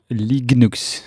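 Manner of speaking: Linux said LIG-nooks, with a g inside; Linux with a hard g before the n; proposed name pronunciation. Shown as a demonstration. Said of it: "a proposed name".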